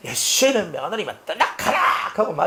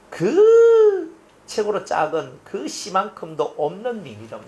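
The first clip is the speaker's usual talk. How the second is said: slow, crying through the words; real tears over weak faith; tearful plea; 5 semitones higher, 4 dB louder, slower than usual. Real, not acted. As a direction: plead with a breaking, weeping voice. Preacher's plea breaks into tears.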